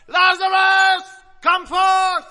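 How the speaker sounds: high, loud, slow calling shout. Pretend. Jesus calling Lazarus out of the tomb, loudly. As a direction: call him out of the tomb. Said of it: "said with a loud voice".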